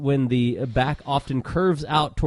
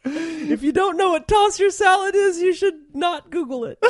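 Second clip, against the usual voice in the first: words squeezed out through high giggles; laughing. Real, not acted. Host warns listeners while cracking up, laughing. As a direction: laugh your way through the warning.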